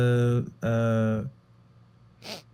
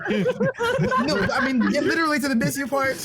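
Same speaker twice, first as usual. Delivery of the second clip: high, fast giggling correction; laughing it off; 12 semitones higher than usual. Real, not acted. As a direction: blurt it out mid-laugh. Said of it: "laughing through the correction".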